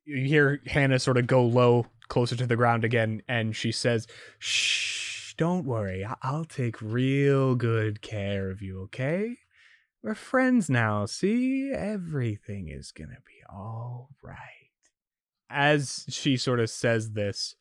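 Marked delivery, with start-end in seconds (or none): 4.4-14.4 soothing hush. Acted. Hannah low, soothing the detective.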